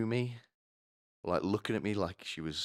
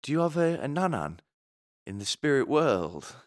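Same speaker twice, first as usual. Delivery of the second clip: psychic lady; 7 semitones higher than usual. Retold or imitated. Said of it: "And she goes".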